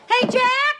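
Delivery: high, loud yell for a roommate; calling out. Acted. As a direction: shout to get his attention. Shouting to get Jack's attention.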